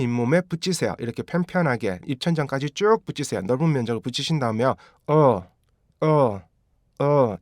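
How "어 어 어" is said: 어 as a short voiced L, tongue on the palate; dark L sound. Shown as a demonstration. Teacher models the L sound, short dark L. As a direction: say "어" as a short, falling uhl. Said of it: "이게 L 발음이에요".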